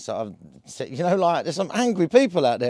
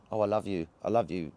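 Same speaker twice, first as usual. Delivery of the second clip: soft, low sweet nothings to a plant; mock loving coo; 7 semitones lower, 7 dB quieter than usual. People at the shop cooing softly to the plant.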